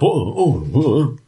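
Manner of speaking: high, startled guard going whoa; a jumpy guard hearing a noise. Acted. The GM as a guard startled by a sound behind him. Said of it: "Good guard noises".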